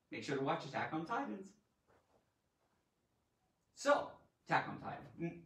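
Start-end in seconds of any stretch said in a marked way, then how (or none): none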